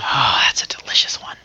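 whispered, savoring food; whispering. Real, not acted. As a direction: breathe it like a secret treat.

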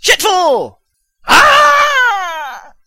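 high, loud nonsense yell; random shouting. Real, not acted. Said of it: "Now you're just making random noises".

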